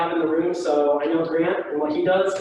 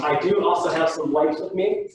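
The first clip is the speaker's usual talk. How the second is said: ordinary delivery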